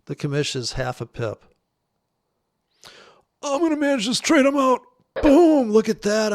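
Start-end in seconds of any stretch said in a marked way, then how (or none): 3.4-6.3 much higher and louder victory shout; triumphant. Real, not acted. Celebrating his winning trade exit, loud excited whoop. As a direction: crow it like a winner.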